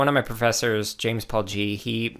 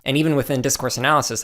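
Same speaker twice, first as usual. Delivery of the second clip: ordinary talk, nothing marked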